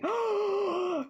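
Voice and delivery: big audible intake of breath; excited gasp. Real, not acted. Streamer to a viewer exhibiting at Lego show, excited gasp. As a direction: gasp with delighted surprise.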